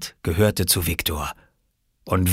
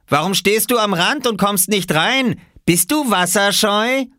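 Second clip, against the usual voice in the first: high, raised teasing voice; taunting call; 13 semitones higher, 4 dB louder than usual. A narrator's reading. Boy in the water getting back at the little one, taunting call. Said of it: "rief er Oskar zu".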